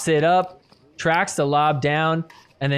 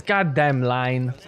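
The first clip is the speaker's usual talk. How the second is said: imitating the player's accent, slow; slow mimicked foreign accent; Jaume's accent; 3 semitones lower, slower than usual. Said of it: "in his awesome accent".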